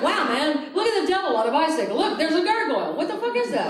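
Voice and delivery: high, loud mock amazement; mocking the cyclist. To the cyclist at the stop sign, high mocking wonder. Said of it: "I was like".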